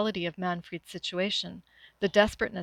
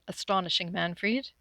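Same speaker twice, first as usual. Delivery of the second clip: ordinary delivery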